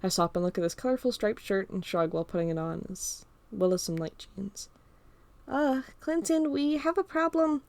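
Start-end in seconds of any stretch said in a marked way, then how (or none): 5.5-7.6 higher, slow, hesitant Carl voice; Carl calling. Acted. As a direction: call up from downstairs with bad news. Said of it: "Carl yells from downstairs".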